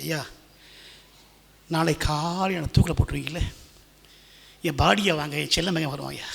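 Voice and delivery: low, soft, sorrowful plea; subdued, grieving request; tearful prisoner. Acted. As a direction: act out a condemned father begging quietly. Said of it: "ஏங்கி ஏங்கி அழுதான்".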